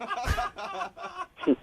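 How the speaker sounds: a sudden high, disbelieving laugh that breaks out; stunned laugh. Real, not acted.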